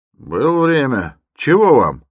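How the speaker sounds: high, loud, drawn-out reply; brusque porter. A narrator's reading. Porter questions the lingering stranger, loud and impatient. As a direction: push the stranger for his business.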